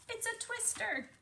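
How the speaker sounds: high alarmed cry. Acted. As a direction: panic at the twister. Alarm at tornado.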